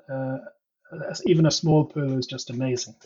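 usual speaking voice

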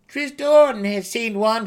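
high, loud defensive gnome; protesting gnome. A narrator's reading. Berated gnome protests to the group, high and loud. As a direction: blurt it out to win the argument. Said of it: "he protested".

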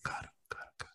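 breathy seductive whisper; sultry whisper. Acted.

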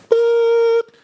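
high beep sound imitation; a high loud boop like a phone tone; voicemail beep.

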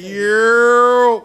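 loud stretched high yeah; hype shout. Real, not acted. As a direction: shout it out to open the show. Podcast intro hype shout.

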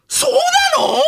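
布川. Acted. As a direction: stretch it into a piercing, amazed question. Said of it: "トム・ブラン、 布川さん". (甲高く伸ばした布川のものまね)

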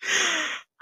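squeaky helpless laugh; high disbelieving laugh. Real, not acted. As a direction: laugh at the absurdity. Laughing at own bad luck.

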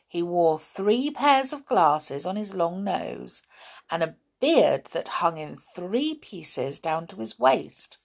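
ordinary delivery, nothing marked

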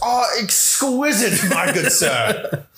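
slow, high mock posh gentleman; posh waiter parody. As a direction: ham up a fancy snob praising cereal. Parodying Key and Peele skit.

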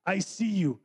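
ordinary talk

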